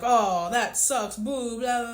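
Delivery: mock people who shrug it off; dismissive mock. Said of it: "we can look at that and be like".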